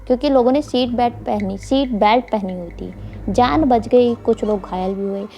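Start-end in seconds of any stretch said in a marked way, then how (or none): none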